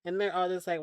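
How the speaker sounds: ordinary talk, nothing marked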